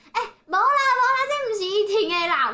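high, loud dismissive imitation; turned-away spousal call. Imitating the old lady calling to her husband, high and loud. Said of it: "边转头边跟他的老板说".

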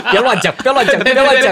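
笑着大声喊不要乱讲; 边笑边高声抗议; laughing protest. Real, not acted. Joking about a memorial, laughing protest. (笑着高声喊停)

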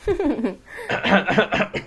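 sick cough into the mask. Real, not acted. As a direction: cough from a cold. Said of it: "感冒咳嗽中的[name]". (感冒咳嗽)

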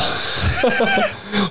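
a sudden squealing burst of laughter; high shrieking laugh. Real, not acted. Cohost laughing at his bad joke, high squealing laugh.

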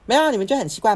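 defensive mom. Retold or imitated. Mom denying she is scolding, loud indignant. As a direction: deny it sharply like an offended mother.

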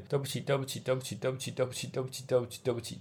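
soft, dutiful, repeated sorry; quiet apologies. Retold or imitated. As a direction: mumble the apologies like a child. Sister repeating apologies obediently. (小声重复道歉)

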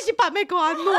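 high, giggling quote of the taunt; laughing retelling. Retold or imitated. Retelling the caller to friends, high and giggling. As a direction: laugh through the caller's line. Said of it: "他说".

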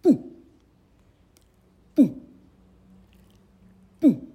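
嗯 forced out through the nose in one push; nasal release demo. Shown as a demonstration. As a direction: hum 嗯 with air trapped behind the tongue. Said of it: "叫做".

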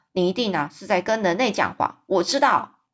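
ordinary delivery, nothing marked

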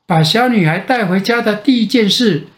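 plain reading voice

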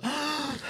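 high breathy gasp; a shocked intake of breath; sharp gasp. Real, not acted. Hearing about the hunk. (倒吸一口气)

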